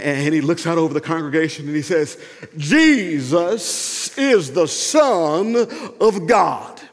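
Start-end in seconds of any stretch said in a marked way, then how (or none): 2.6-6.8 very slow, drawn-out pulpit cry; old-school elderly preacher. Acted.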